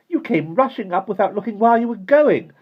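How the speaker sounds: high, loud accusing retort; spirited protest. A narrator's reading. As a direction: throw the blame back with spirit. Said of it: "with some spirit".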